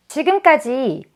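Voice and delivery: usual speaking voice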